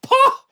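high, sharp dismissive pah; scornful scoff. Acted. Friend dismisses raccoon's American excuse, high scoff. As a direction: scoff at the claim.

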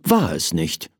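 ordinary delivery, nothing marked

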